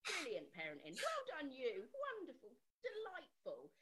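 high, effusive mock praise; gushing praise. School praising mum about her daughter, mock gushing. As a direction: heap on exaggerated, swooping compliments.

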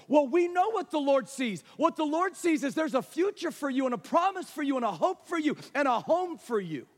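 high, emphatic pulpit delivery; forceful preaching. Real, not acted. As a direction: pile up the promises with conviction.

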